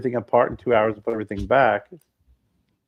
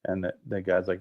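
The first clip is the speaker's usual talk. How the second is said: ordinary talk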